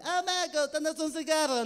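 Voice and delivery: exaggerated beggar voice asking for a cigarette; mock Romanian voice. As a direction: ham up a stranger bumming a smoke. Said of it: "esta que suena como una E".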